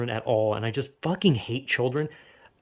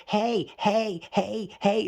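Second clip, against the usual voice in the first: put-on higher nagging kid voice tugging at you; child nagging imitation; 6 semitones higher, much slower than usual. Vlogger mimicking a child pulling at his sleeve. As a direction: whine hey hey like a pestering child.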